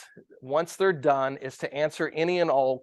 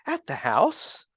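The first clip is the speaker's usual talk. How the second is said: lightly voiced little boy, slow and innocent; kid's answer; 5 semitones higher, slower than usual. A hint of a little boy telling his mom where he got it. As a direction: play it as a small child, unbothered. Boy caught with DVD player.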